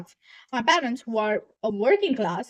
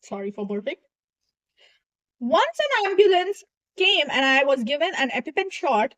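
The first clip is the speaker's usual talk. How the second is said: ordinary talk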